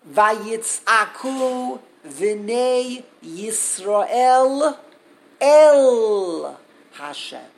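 loud, very slow, stretched out verse; drawn-out cry. Acted. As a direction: let the verse ring out like a cry, swelling on el Hashem. Dramatic reading of the cry verse.

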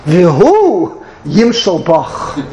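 slow, ominous recitation; ominous verse. Retold or imitated. Guest reciting to the bride, slow and ominous. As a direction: let the words fall heavy and slow. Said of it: "It should be with torture".

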